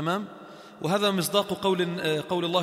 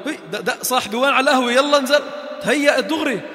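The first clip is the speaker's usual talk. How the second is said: louder, higher call to a friend; 5 semitones higher, 5 dB louder than usual. Acted. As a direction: call your buddy over to the café. Friend calling him to café. (نداء لصديق بصوت مرتفع)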